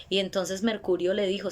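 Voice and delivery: ordinary delivery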